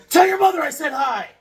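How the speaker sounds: high, loud call across the room. Real, not acted. Calling a goodbye after Sonia, loud and high. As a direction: call it after her as she goes.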